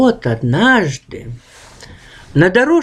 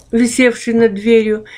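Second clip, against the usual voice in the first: usual speaking voice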